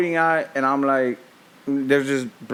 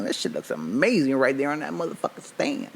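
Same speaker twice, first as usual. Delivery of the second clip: ordinary talk, nothing marked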